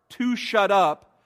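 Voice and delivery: normal talking voice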